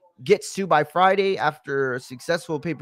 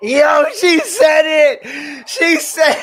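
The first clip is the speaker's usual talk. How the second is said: high, slow, stretched-out shout of disbelief; hyped shout; 10 semitones higher, 5 dB louder, much slower than usual. Real, not acted. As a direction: yell it out, hyped by the line. Streamer hyped at wrestling promo.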